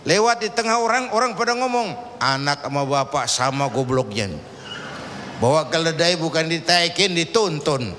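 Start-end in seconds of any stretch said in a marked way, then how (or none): 2.2-7.9 mocking bystanders. Passers-by mock father and son.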